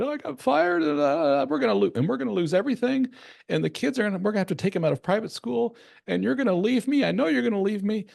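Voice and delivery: exaggerated sobbing-man impression, high and pleading; mock wailing man. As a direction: play the inconsolable husband, pitch way up and falling apart. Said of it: "and just starts wailing and he's inconsolable".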